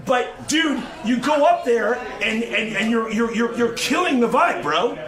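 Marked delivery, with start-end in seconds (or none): none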